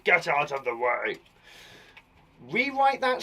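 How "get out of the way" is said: restrained mock shout; a hint of a shouting man. Man clearing the way, restrained shout. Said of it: "The man shouted".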